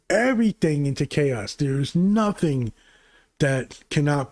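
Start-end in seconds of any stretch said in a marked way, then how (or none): none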